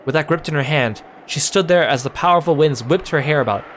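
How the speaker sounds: usual speaking voice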